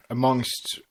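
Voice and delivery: normal talking voice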